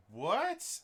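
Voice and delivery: high, loud, puzzled yelp; imitated incredulous what; retold baffled question. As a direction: blurt it in mock confusion. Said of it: "And she".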